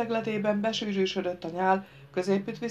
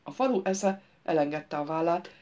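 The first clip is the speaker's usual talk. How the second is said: ordinary delivery, nothing marked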